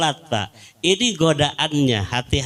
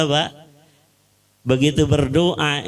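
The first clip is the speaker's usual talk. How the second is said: ordinary talk, nothing marked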